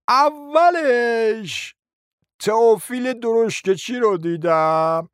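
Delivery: slow, drawn-out recollecting; doddering uncle. Acted. Uncle trying to remember for his nephew, slow and dithering. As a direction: stretch the words as memory crawls along. Old uncle recalls slowly.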